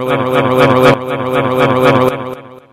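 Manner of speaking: loud, repeated like a skipping record; looping loud echo; echoing glitch. Acted. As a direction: stutter it like a broken jingle. Stuttering jingle gag.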